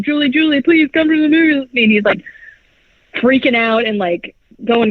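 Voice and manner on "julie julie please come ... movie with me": mock desperate plea, higher and hurried; frantic pleading. Stalker banging on Julie's door, frantic pleading. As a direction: imitate someone pleading through a locked door. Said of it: "banging on the door and going".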